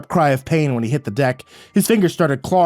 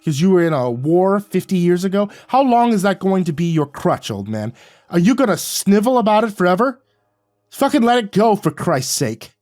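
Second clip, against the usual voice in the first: higher, louder angry yelling; shouting; 7 semitones higher, 3 dB louder than usual. A narrator's reading. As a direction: yell it in his face. Shouting at Jack in a fight.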